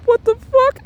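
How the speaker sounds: very high laughing squeal; helpless, high-pitched incredulous laugh; losing it laughing. Retold or imitated. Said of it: "losing my shit".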